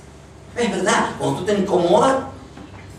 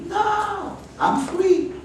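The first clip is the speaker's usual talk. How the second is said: defiant high voice; much slower than usual. Retold or imitated. Preacher voicing herself brushing off trouble in a high, defiant voice. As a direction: jump way up into a high, far-off voice. Defying stress, quoting herself to Lord.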